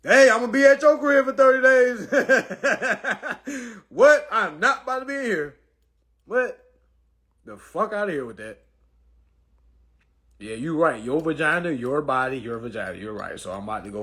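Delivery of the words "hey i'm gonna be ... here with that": high, loud incredulous yell; hammed-up disbelief; mock outraged shout. Mocking his reaction to celibacy, high loud yell.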